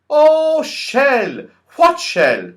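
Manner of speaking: high, loud puzzled voice; deaf Saucepan Man. A narrator's reading. Deaf Saucepan Man mishearing Franny, high and loud. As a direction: mishear and sound baffled. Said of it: "said the Saucepan Man".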